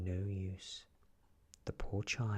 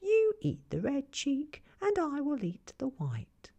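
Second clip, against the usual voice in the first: put-on high, sly old peddler woman; queen's sly voice; 8 dB louder than usual. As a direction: coax her with a crafty high voice. Queen offers poisoned apple.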